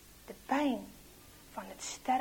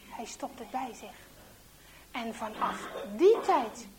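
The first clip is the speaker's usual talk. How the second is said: ordinary delivery, nothing marked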